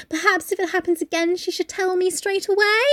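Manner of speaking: sweetly pointed, lilting up at the end; prim passive-aggressive housekeeper. Retold or imitated. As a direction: say it with veiled reproach. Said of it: "it's the way she says it".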